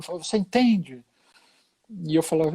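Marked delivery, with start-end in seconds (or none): 0.2-1.0 mild mock yell, a little raised; retold shout. Angry patient to the hospital chaplain, mildly imitated shouting. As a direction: imitate an upset man demanding understanding. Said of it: "E ele gritava comigo".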